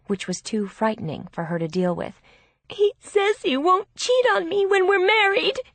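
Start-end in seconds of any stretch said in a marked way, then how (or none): 2.7-5.6 high, loud wailing protest; distraught, high-pitched pleading; tearful insistence. A narrator's reading. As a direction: defend him desperately in a very high voice. Sister tearfully defends her fiancé.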